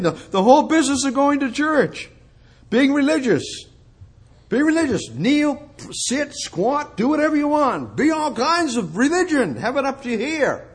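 high, sing-song mockery of churchgoing; mock the busy religious routine; mocking religiosity.

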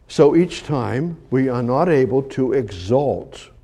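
hushed preaching. Acted. Quiet intimate preaching stretch.

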